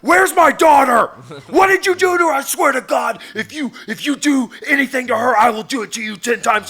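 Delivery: high, loud, frantic shouted threat; mock frantic yelling. Trolling the scammer, frantic mock father yelling. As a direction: yell like a desperate father. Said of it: "I just started yelling at her".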